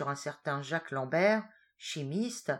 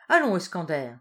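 a firm, louder call to pull him together; whiplike rallying call; 8 dB louder than usual. A narrator's reading. Boss rousing his weeping reporter, a sharp louder call. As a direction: snap him out of it with one sharp word. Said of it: "Sous ce coup de fouet".

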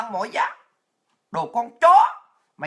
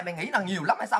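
ordinary talk, nothing marked

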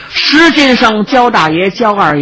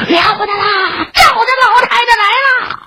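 high alarmed cry raised in pitch; panicked shout. Acted. Servant crying the alarm to the household, high panicked shout. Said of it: "就听家人高声喊嚷".

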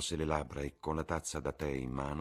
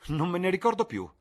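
much higher, louder, delighted invitation; exuberant Lord Henry; 8 dB louder than usual. Acted. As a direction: sweep them along gleefully. (Lord Henry esuberante, molto più alto e forte)

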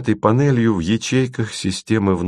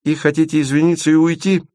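high-pitched earnest prompting; mock-serious; 8 semitones higher, faster than usual. A narrator's reading. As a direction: add it high, as a serious question. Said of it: "серьёзным видом добавил Леденец". (с серьёзным видом)

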